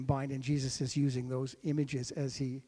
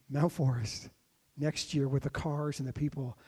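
normal talking voice

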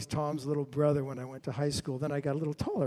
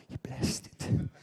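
hushed aside; 3 semitones lower, slower than usual. Real, not acted. Preacher hushes at key moment.

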